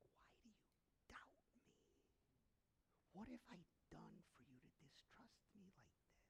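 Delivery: very soft, whispered pleading; hushed, aching questions; hurt whisper. Acted. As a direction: whisper it like a wounded loved one. God's hurt answer during prayer.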